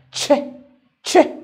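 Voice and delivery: short, punched "چه", said separately twice; letter's sound. Shown as a demonstration. Teacher showing how the letter is pronounced, short sound "چه". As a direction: say "چه" as a quick bare "che".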